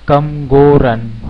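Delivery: कम, गो, रन each said in one beat; single-syllable words. Shown as a demonstration. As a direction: say कम, गो, रन as three separate one-jolt words. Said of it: "एक झटके में बोले जाते हैं".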